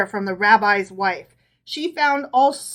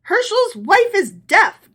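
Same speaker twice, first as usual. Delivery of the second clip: gasped; 10 semitones higher, 6 dB louder than usual. A narrator's reading. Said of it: "gasped his wife".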